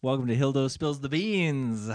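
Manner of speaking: slow, smooth radio host welcome. Pretend. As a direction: announce it like a DJ. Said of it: "You can hear my radio voice now".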